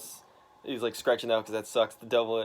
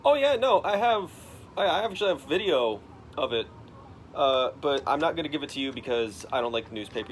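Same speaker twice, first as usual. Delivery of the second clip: excited witness; 3 semitones higher, 3 dB louder than usual. Retold or imitated. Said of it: "this one guy who's like".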